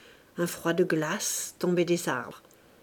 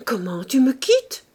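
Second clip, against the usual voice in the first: surprised cry; 5 semitones higher, 6 dB louder than usual. Acted. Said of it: "s'écria Sandoz".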